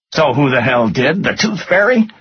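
high, mocking question; sarcastic. A narrator's reading. Finance guy mocking his boss's denial, sarcastic. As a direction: pitch it up into a scornful question. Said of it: "nodded sarcastically".

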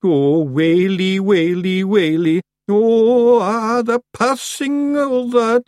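high, loud, drawn-out wail; comic wailing. Acted. The one Feegle who has not fallen silent, comic wailing. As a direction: stretch each word into a slow lament.

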